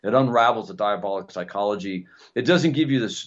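ordinary talk

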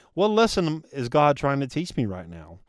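ordinary delivery

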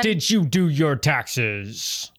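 very slow, stretched-out question; drawn-out echo. Pretend. Host echoes co-host's question to everyone, slow mock call-out. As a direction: drag every word out in a put-on voice.

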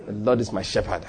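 low, soft murmur; to yourself. Shown as a demonstration. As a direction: repeat it low and softly to yourself. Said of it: "Say it to yourself".

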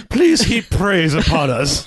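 high, drawn-out mock begging; mock pleading. Host jokingly begging listeners for praise, high pleading. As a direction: plead grandly and slowly, as a joke.

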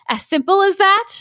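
high rising disbelief; incredulous. Acted. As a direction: ask it in disbelief. Incredulous question then answer.

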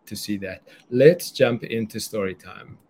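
normal talking voice

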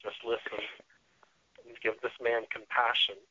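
soft, calming self-talk; quiet, compassionate reminder to yourself; mindful inner voice. Acted. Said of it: "say with my mindfulness".

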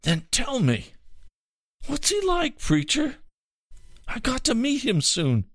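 high, strained, desperate pleading. Acted. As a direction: beg like a frightened dying man. Dying man pleads to know God.

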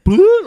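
high, loud imitated bloop; phone notification sound. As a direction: mimic a phone alert. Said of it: "my phone is like".

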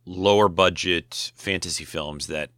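normal talking voice

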